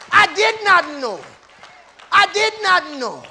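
high, loud, punched-out declaration; triumphant pulpit cry; emphatic preaching shout. Real, not acted. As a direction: shout it phrase by phrase. Emphatic answer to imagined questioners.

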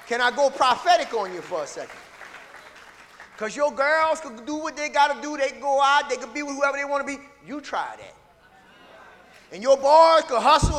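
loud, rising pulpit preaching; preaching at full force. Acted. Preacher exhorting his church, full-force preaching. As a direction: drive it home to the congregation.

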